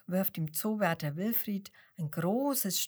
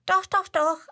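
high, loud bright chirps; cheerful insisting; 11 semitones higher, 9 dB louder than usual. A narrator's reading. Penguin cheerfully contradicting the grumpy lion, playful. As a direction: insist playfully, much higher and louder. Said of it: "antwortet der Pinguin noch einmal und lacht".

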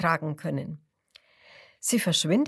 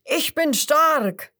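loud, higher, slow roaring boast; crocodile roar; 7 semitones higher, 7 dB louder, slower than usual. A narrator's reading. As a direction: bellow it like a proud croc. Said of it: "Das erste ruft". (lautes, langsames Brüllen)

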